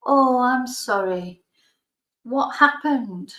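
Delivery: halting, careful condolence; awkward sympathy. Retold or imitated. Drunk teacher offering condolences to widower, slow.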